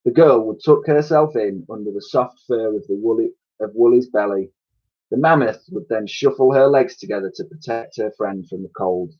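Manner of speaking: usual speaking voice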